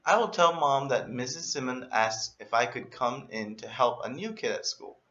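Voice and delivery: usual speaking voice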